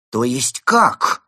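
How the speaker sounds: loud, drawn-out puzzled question; bewildered. Acted. Frenzied bird to the villains, loudly bewildered. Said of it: "раздался недоумевающий голос".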